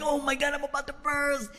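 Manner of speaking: high, soft desperate squeal; mock desperation. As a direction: whimper it, about to lose control. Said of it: "I'm, like, sweating".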